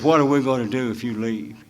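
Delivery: ordinary talk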